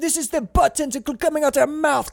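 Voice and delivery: high hammed-up director voice; excited director. Director pitching tentacle shot to actor, hammed-up.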